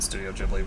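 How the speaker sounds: "Ghibli" with a soft G, like "jib-lee"; soft G pronunciation. Shown as a demonstration. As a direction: say "Ghibli" starting with a J sound. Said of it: "I've always pronounced it".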